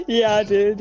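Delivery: normal talking voice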